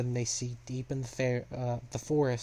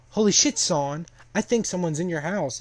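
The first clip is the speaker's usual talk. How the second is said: raised, louder warning; acted-out urgent heads-up; mock alarm; 5 semitones higher, 6 dB louder than usual. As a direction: sound the alarm, higher and louder. Character warns of an intruder.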